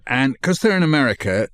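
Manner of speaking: ordinary talk, nothing marked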